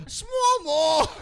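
squeaky teasing small mall; mocking crew. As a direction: crew teasing her accent, high mock voice. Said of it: "they go".

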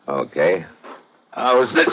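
normal talking voice

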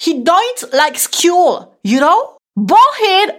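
high, loud, hammed-up Scottish accent; accent écossais. Shown as a demonstration. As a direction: overdo a comic Scottish accent, drawn out. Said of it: "avant-garde comique avec accent". (accent écossais caricatural, aigu et appuyé)

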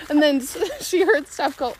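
usual speaking voice

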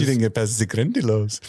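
mock French accent slipping into German; accidental German accent. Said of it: "I did a German accent there".

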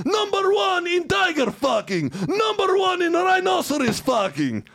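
high, full-volume ranting announcer; shouted mock promo. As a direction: shout it like an over-the-top propaganda ad, pitch way up. Performs mock Russian promo.

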